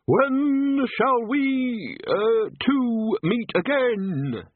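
high, slow eldritch witch shriek. Acted. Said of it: "An eldritch voice shrieked".

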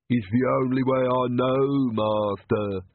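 slow, strange servant voice; Igor. A narrator's reading. Igor answers his vampire master, slowly. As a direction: drawl it like a hunchback lackey. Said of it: "said Igor".

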